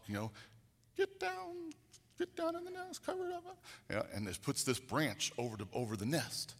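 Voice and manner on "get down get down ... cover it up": very high squeaky bird voice urging the chicks; mother bird talk. Retold or imitated. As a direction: chirp it out like a frantic mother bird. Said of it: "in bird talk".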